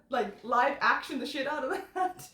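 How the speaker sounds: ordinary talk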